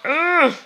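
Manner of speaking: loud, high mock groan; hammed-up "ugh"; frustrated groan. Frustrated at the game score.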